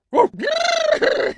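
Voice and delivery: very high animal squeal; silly high-pitched imitated animal call; animal noises. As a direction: make a daft creature noise. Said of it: "it's just gonna be animal noises".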